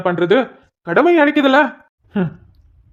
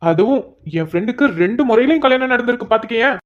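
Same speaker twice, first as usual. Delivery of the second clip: normal talking voice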